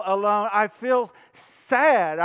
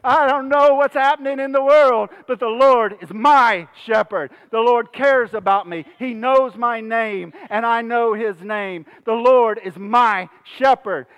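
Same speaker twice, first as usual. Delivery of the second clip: loud, raised pulpit voice; fervent declaration; 5 dB louder than usual. Real, not acted. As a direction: proclaim it with conviction. Preaches a declaration of faith.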